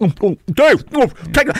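high, excited offer in bursts; over-eager, hammed-up pestering; eager sandwich pushing. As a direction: urge it on him with high, loud oohs. Acts out offering Dave his sandwich.

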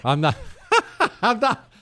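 high-pitched laughing protest; laughing denial. Real, not acted. Host denying to Chris that he mocks people, laughing. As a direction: burst out laughing, then protest.

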